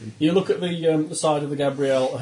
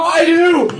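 loud, high-pitched excited outburst; eager shout; 12 semitones higher, 9 dB louder than usual. Acted. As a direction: shout it out, jumping in to volunteer. Volunteers eagerly for first aid.

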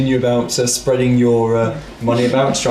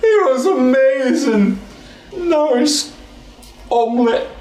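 high slow NPC voice; 11 semitones higher, slower than usual. Acted.

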